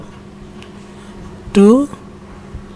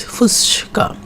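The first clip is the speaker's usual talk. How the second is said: whisper; 3 semitones higher, 4 dB quieter, much slower than usual. Shown as a demonstration. Whispered demo.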